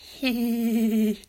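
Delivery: high, loud squeaky dolphin laugh; Irish dolphin squeal. Pretend. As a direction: chirp like a giddy dolphin. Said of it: "my impression of an Irish dolphin".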